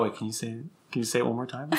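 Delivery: hushed imitation of friends stopping you; imitating friends. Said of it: "they'll stop me and they go".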